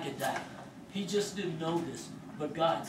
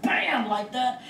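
loud, higher mock bang; table slam; 8 semitones higher, 9 dB louder, slower than usual. Retelling the inmate slamming his arm on the table, loud bang. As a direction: hit the bam like a fist on wood.

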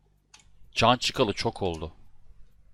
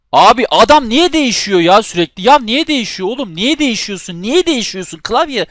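furious rant; 11 semitones higher, 9 dB louder than usual. Real, not acted. Raging at the controller switching players.

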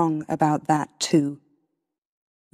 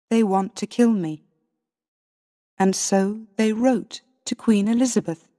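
plain reading voice

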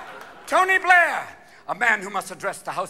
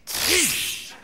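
hushing hiss; 10 semitones higher than usual. Retold or imitated. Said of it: "the Pope gathered all the cardinals in Rome and went".

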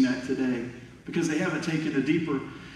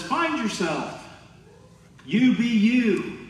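a hint of a breezy self-help guru, higher and slow; mock self-help voice; 8 semitones higher, 4 dB louder, slower than usual. Preacher mocking the world's slogans, lightly hammed-up guru voice. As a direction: lightly parody the world's feel-good advice.

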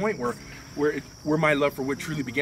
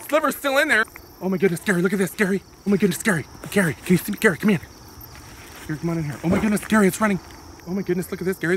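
excited; 5 semitones higher, 4 dB louder, faster than usual. Acted. Excited over horses running.

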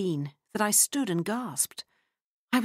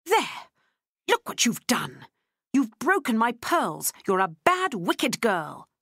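raised, scolding furious cry; 6 semitones higher, 3 dB louder, slower than usual. A narrator's reading. Lottie scolding Freddie over the pearls, mild fury. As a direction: scold the child hard, mildly hammed. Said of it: "cried Lottie furiously".

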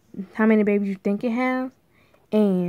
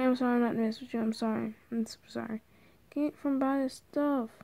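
ordinary talk, nothing marked

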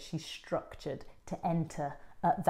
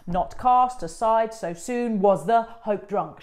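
slow and louder, "cast" hit hard; iambic stress; 4 semitones higher, 8 dB louder, slower than usual. Shown as a demonstration. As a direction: beat it out firmly for the actress. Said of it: "she must come straight in".

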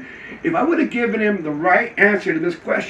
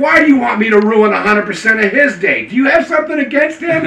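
loud, high re-enacted tirade; worked-up mock menace; defiant threat; 6 semitones higher, 7 dB louder than usual. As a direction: warn them loudly, hammering each phrase. Retold loud threat at security call.

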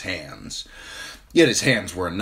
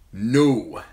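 brusque; 4 semitones higher, 6 dB louder than usual. A narrator's reading. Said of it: "His voice was strange, brusque".

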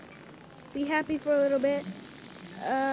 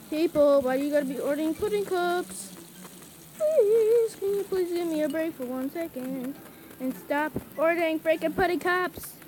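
exasperated pleading; 2 semitones higher than usual. Real, not acted. Player fed up with pudding cup orders, exasperated pleading. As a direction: whine and beg for a break.

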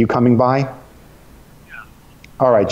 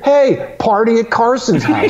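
high, rowdy party cheer; mock drunk cheer; 9 semitones higher than usual. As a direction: call it out like a tipsy barfly.

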